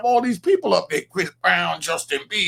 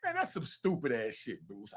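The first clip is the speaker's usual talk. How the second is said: low, softer blunt dismissal; a hushed but blunt put-down; muttered verdict; 8 semitones lower, 12 dB quieter than usual. Real, not acted. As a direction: mutter it under your breath. Scolding the lawyer Bruce.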